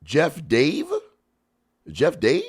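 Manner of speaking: ordinary talk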